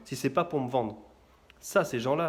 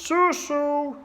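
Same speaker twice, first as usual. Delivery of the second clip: beach vendor cry; 11 semitones higher, 4 dB louder than usual. Retold or imitated.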